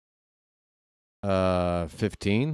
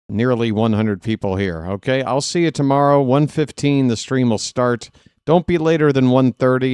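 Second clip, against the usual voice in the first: usual speaking voice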